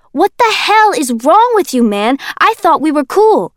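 high, loud, outraged yell; furious at being hit; Kenji, outraged. Acted.

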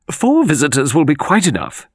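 high, firm lady's voice; imperious old mistress. A narrator's reading. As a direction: dismiss it crisply as the mistress of the house. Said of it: "said Miss Arundel".

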